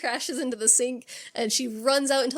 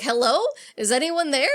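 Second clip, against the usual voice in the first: louder, nervous calling out into the room; 4 semitones higher, 5 dB louder than usual. Retold or imitated. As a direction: call out to an empty bathroom. Sidney calling out.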